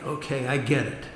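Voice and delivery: ordinary delivery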